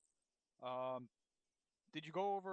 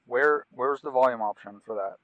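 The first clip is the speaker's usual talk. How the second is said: loud urgent order; shouting over engine noise; 3 semitones lower, 22 dB louder than usual. Real, not acted. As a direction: yell over the rotor noise.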